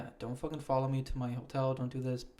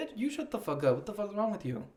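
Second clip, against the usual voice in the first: sassy comeback; 8 semitones higher than usual. Retold or imitated. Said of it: "I'm gonna be like".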